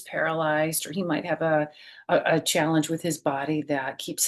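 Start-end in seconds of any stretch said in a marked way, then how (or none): none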